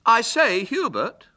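high, loud breezy hail; airy. A narrator's reading. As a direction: hail him airily. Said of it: "said Douglas airily".

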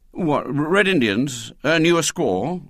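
schoolboy warming to an idea; gleam of interest. A narrator's reading. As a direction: suggest it with budding interest. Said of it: "with a gleam of interest".

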